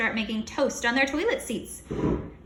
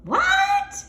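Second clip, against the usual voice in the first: very high, loud cry of shock; shocked shout; 8 dB louder than usual. Acted. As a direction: yelp it in disbelief. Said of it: "we all shouted".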